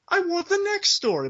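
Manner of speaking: high-pitched demanding fan; impatient fans. Retold or imitated. Fans let down by a prequel, high-pitched mock demand.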